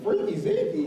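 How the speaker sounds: high, lightly voiced swooning woman; a hint of a smitten girlfriend. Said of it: "she like".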